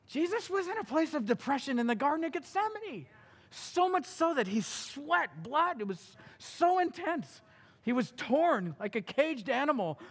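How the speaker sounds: high, loud impassioned sermon; preaching at full force. Acted. Preacher describing Gethsemane, impassioned and loud. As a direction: pour it all out.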